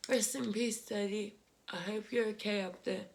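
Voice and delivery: tender farewell. Real, not acted. Farewell to late father.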